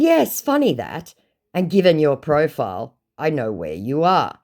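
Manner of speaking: mock-amused comeback; pointed retort. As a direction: retell a knowing jab at the scammer. First take, acted retort.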